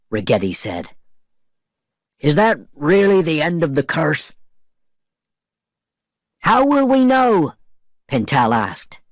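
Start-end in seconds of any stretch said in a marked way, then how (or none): 2.2-7.6 pirate voices. A narrator's reading. Pirates doubt the curse.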